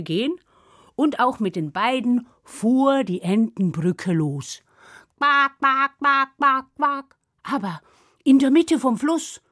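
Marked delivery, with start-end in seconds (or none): 5.2-7.2 firm, evenly spaced quacks; duck quacking. Acted. Ducks set off carrying the ogres, quacking. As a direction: quack in marching rhythm.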